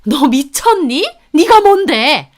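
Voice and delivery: high, loud hysterical shriek; screaming in outrage at the sister-in-law. Acted. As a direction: shriek like a fit of rage. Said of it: "발작하듯 소리를 지르더라고요".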